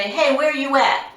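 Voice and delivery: mock rude phone caller; sloppy casual caller. Shown as a demonstration. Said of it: "Please don't say".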